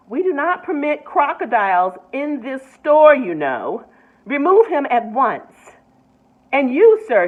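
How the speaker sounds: loud stern orders; angry manager. A narrator's reading. Mr Grumps ordering Mrs Prim out, angry. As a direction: lay down the rules, fuming.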